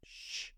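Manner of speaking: soft, voiceless shush; drawn-out "shh"; shushing hiss. A narrator's reading. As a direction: hush someone with a quiet shh. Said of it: "the Hatter and the March Hare went".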